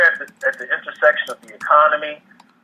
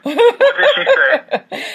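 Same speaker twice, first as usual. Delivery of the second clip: high, slow question broken by laughter; laughing question; 13 semitones higher, 4 dB louder, much slower than usual. Real, not acted. Host reads a funny comment, guest asks laughing.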